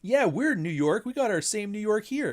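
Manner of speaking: high, loud mock boast; mock a Toronto local posing as a New Yorker; wannabe New Yorker. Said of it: "desperately wanna be New Yorkers".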